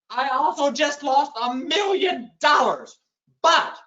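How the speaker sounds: loud disbelieving outburst; exasperated shout. Real, not acted. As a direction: shout it out in comic despair. Realizing he lost the bet.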